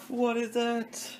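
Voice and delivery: squeaky high "What is that?" meme; drawn-out high-pitched bit; mock high meme voice. Goofing at the dog in a squeaky voice.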